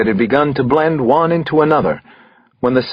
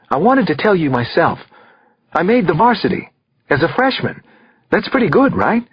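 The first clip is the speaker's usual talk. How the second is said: put-on higher teenage boy sharing good news; lightly voiced boy, pleased with himself; fourteen-year-old son; 7 semitones higher than usual. A narrator's reading. Son telling dad his school news, teenage boy voice.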